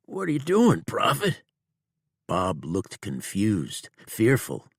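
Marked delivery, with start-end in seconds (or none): 0.1-1.4 high, strained frightened outlaw; frightened wounded outlaw, high and tense; Bob the outlaw. A narrator's reading. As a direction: voice the scared, hurting outlaw, pitched high. Bob's voice in the story.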